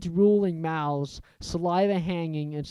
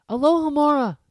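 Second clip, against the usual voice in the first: plain reading voice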